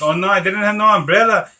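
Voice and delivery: high, loud mock wail of dismay; retold dismay. Storyteller reliving the rain starting, dismayed. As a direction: cry out as if caught without cover.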